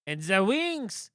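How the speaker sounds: loud, high, slow barking guard. Acted. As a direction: bellow like a pompous royal guard. Guard lists features, slow.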